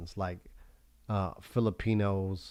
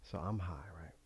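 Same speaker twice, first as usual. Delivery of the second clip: whispering; 3 semitones lower, 13 dB quieter than usual. Real, not acted. Whispered confession aside.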